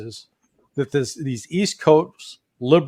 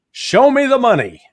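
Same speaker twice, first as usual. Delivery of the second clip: high-pitched movie catchphrase shout; Cuba Gooding Junior; 9 semitones higher, 3 dB louder than usual. Acted. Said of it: "Cuba Gooding Junior, that actor".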